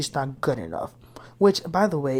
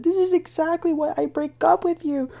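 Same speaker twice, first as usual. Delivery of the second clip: mock scream; 13 semitones higher than usual. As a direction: imitate someone screaming from the top, pitched way up. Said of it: "she's screaming from the top".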